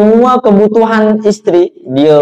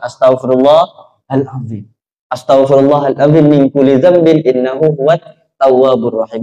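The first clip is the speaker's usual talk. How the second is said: recited istighfar; 5 semitones lower than usual. Retold or imitated. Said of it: "dia beristighfar".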